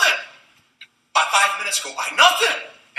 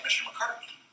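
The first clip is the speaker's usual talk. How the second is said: unvoiced, hushed name drop; whispered; 3 semitones higher, 14 dB quieter than usual. Real, not acted. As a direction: breathe the name like an aside.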